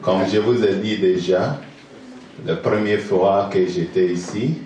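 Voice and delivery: usual speaking voice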